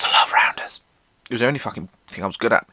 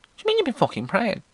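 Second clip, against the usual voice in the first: high, fast, outraged mother; shocked mother; 12 semitones higher, faster than usual. Retold or imitated. Mother hearing her son prayed all day, high and fast. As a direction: fire it back in disbelief. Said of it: "She went".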